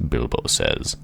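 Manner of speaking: ordinary delivery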